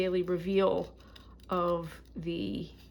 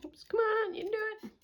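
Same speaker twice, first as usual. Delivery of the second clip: coaxing; 14 semitones higher, 3 dB quieter, faster than usual. Real, not acted. Coaxing her knitting.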